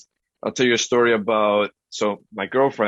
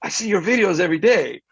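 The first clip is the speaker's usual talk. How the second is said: high-pitched imitation of a fan; eager fan; 7 semitones higher than usual. Fan telling him about his videos, high and eager. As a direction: mimic an enthusiastic admirer, pitch raised.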